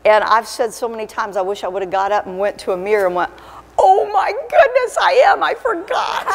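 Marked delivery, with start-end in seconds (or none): 3.8-6.3 high, drawn-out mock shock; mock astonishment. Woman acting out her look in the mirror, mock astonishment. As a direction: feign a sudden high-pitched discovery.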